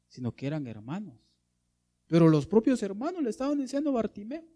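ordinary talk

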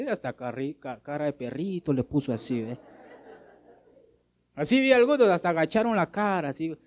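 normal talking voice

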